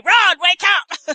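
slow, high shout; loud wake-up call. Acted. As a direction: call him awake loudly. Shaking Ron awake in retelling.

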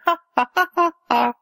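high fake "ha ha" taunt; mocking laughter. [name] and [name] tease the others, mock laughing. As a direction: imitate teasing laughter, each ha separate. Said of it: "take the mickey out".

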